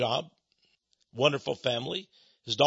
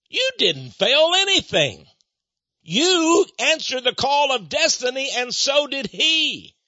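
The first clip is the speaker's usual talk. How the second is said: loud, high, drawn-out exhortation; full-force preaching; 11 semitones higher, 6 dB louder, slower than usual. Acted. Preacher reassures those who feel like failures, preaching at full force. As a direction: preach it slowly with soaring force.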